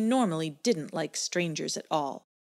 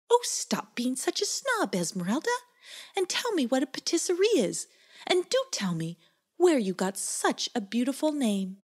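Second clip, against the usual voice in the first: teasing goop voice; 7 semitones higher than usual. Acted. Nibbeline teasing Esmeralda, high voice.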